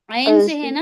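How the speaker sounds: عین pushed up and loud; stress on عین. Shown as a demonstration. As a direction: hit the word عین. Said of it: "عظیم". (عین پر زور)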